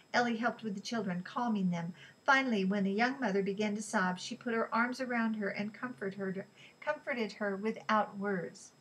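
ordinary delivery, nothing marked

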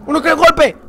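very high, loud yell; piercingly high shout; screamed outburst. Real, not acted. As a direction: scream it out in shock. Screams after narrow escape.

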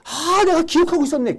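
high delighted inner voice of a student; mock the student's joy at remembering; student's glee. Teacher voicing a student's delight at remembering.

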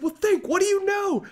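high, loud urgent quizzing; demanding coach. Retold or imitated. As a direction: press him like a coach. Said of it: "And he's like".

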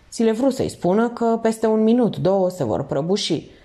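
plain reading voice

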